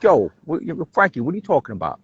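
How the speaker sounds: put-on thick Brooklyn accent, lightly done. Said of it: "I would throw it on real thick".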